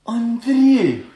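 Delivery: high, excited cries of greeting; overjoyed recognition. Acted. Old friends recognize each other after years, overjoyed. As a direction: call out in joyful disbelief.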